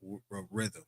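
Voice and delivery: normal talking voice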